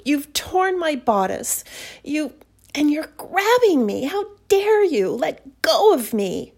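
high, loud panicked protesting; struggling protest. Acted. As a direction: fight him off, shrieking. Struggles and protests as he pins her.

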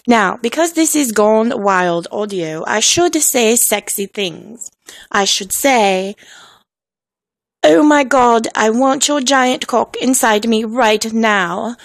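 slow, hammed-up British delivery; bad British accent. Host saying sexy things as a joke, bad British accent. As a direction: play it up in a fake British accent. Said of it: "post in a bad accent".

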